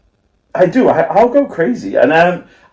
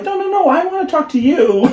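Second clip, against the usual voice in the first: high, pleading no no no; mock clingy reply; 14 semitones higher than usual. Stalling a scammer in a high mock plea. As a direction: stall them like a needy texter. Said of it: "you're like".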